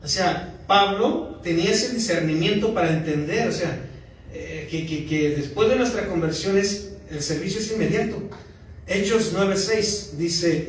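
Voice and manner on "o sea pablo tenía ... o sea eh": usual speaking voice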